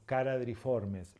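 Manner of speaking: "caradriformes" slowly, starting with hard k. Shown as a demonstration. Teacher giving an example, slow hard k in "caradriformes". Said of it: "no se pronuncia como ch".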